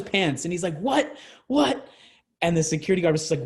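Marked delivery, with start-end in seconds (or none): 0.8-1.9 put-on high, incredulous drunk "What? What?"; drunken outburst. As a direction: play the pantsless drunk, pitch jumping way up. Drunk friend yelling pants off.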